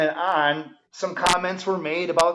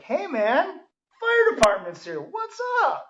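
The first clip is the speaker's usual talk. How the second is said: high-pitched, drawn-out party greeting; hammed-up delight, pitch soaring on the last words; mock happy welcome; 12 semitones higher, slower than usual. Partygoer greeting the arriving fire department, mock happy welcome.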